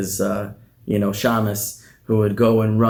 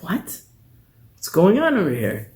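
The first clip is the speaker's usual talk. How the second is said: high, startled "What's going on over here?"; a bewildered messenger cries out; baffled outburst; 9 semitones higher than usual. Acted. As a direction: squeak it out in total confusion. Messenger baffled by blank letter.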